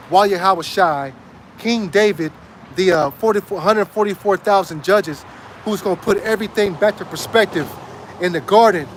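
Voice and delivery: ordinary talk, nothing marked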